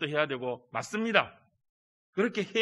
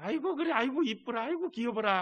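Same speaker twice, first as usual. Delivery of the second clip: doting grandparent; 7 semitones higher than usual. Acted.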